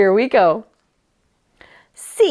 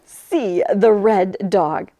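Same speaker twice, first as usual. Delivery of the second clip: slow, drawn-out picture-book reading; reading to children; 4 semitones lower, much slower than usual. A narrator's reading. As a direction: read each word slowly for little ones. Narration to children.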